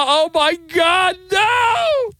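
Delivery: shrieking falsetto oh my God no; mock shriek. Host mocking the outraged public, falsetto shriek. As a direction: squeal it like a scandalized prude.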